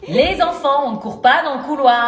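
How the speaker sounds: loud parental call; firm, loud reprimand down the corridor; calling to children. Acted. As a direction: raise your voice to stop running children. Parent calls out to running children.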